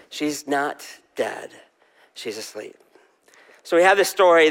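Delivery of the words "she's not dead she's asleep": soft repeat. Shown as a demonstration. Preacher repeats line softly.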